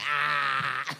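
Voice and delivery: retold laugh at friend. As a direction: laugh it off mockingly.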